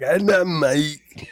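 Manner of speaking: slow, drawn-out singer impression. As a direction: stretch each word out in a put-on voice. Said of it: "supposed to do the [name]".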